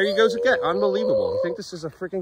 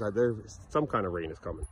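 ordinary delivery

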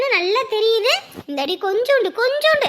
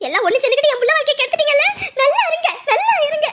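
high, heated reproach; bitter accusation; 4 semitones higher, faster than usual. Acted. Mother accusing family over her daughter's marriage, heated and bitter. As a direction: hurl the blame at them, voice rising.

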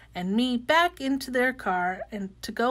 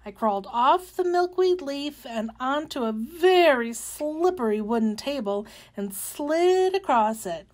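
usual speaking voice

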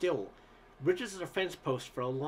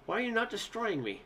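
anguished cry; 6 semitones higher than usual. A narrator's reading. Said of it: "he cried".

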